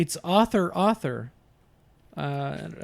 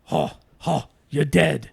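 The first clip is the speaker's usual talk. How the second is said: deep gangster Pacino taunt; Pacino big boy voice; 4 semitones lower than usual. Shown as a demonstration. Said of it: "especially big boy voice".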